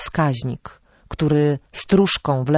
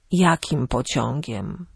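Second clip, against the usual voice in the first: put-on slow, subdued old man's question; frail, bewildered grandfather; confused grandfather; 3 semitones lower, 4 dB quieter, slower than usual. A narrator's reading. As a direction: interrupt slowly and weakly, puzzled. Confused grandfather cuts her off.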